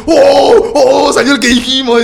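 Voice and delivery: high excited whoops of a win; excited cheering. Retold or imitated. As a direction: cheer like gamblers who just won. Imitating neighbours celebrating at casino.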